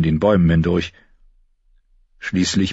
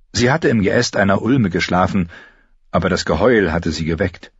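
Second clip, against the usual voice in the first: ordinary talk, nothing marked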